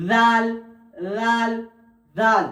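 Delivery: লাল with tongue tip on the upper teeth; careful letter drill; Arabic letter demo. Shown as a demonstration. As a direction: repeat লাল clearly. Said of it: "এবারে দাল".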